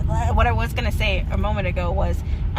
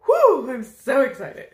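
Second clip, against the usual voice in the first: excited; 6 semitones higher, 6 dB louder, slower than usual. Retold or imitated. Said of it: "I just wanted to ... and say".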